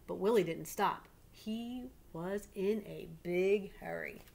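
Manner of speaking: ordinary talk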